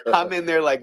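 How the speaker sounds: ordinary delivery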